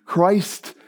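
ordinary delivery

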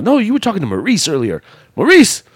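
high, quick retort leaping up on the name; hammed-up high-pitched disbelief; mock indignant. Wrestler disputing who he was talking to, mock indignant. Acted argument over who is who.

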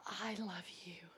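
soft reassuring whisper; tender whisper. Retold or imitated. Preacher reassuring the weeping inmate in a tender whisper. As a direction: comfort her gently, barely voiced.

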